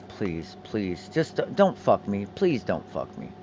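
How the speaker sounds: hushed begging under the breath; quiet pleading. Real, not acted. Streamer softly begging the crafting roll to land, hushed plea. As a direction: plead softly with the dice not to fail.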